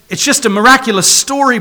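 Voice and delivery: ordinary delivery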